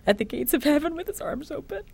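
comforting. Retold or imitated. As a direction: soothe a frightened child.

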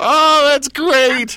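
high, drawn-out laugh through the words; can barely get it out for laughing; laughing hard. Real, not acted. Host cracking up at the song pick, laughing through it.

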